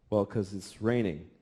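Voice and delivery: worn-out dad, low and quiet; weary dad. Retold or imitated. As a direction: answer like an exhausted father. Tired dad answering daughter.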